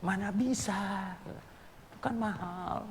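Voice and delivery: a hint of the doubting wife; wife answers her husband, a light mock protest; imitating the wife. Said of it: "Tahu nggak ibu bilang apa?".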